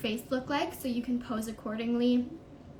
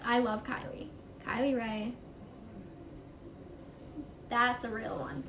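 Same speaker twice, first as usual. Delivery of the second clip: usual speaking voice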